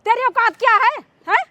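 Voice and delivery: outraged mother's tirade; scornful, indignant scolding; angry scolding. Acted. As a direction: berate him with indignant questions. Acted mother berates servant.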